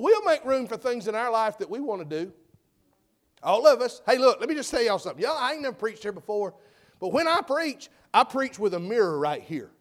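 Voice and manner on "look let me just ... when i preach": normal talking voice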